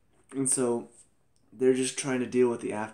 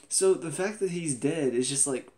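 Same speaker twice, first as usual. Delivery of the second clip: ordinary talk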